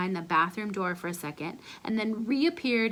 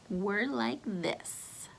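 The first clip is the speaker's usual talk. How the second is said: slow, much softer drawn-out aside; hushed boast; 9 dB quieter, much slower than usual. Acted. Confiding closeness, slow and hushed.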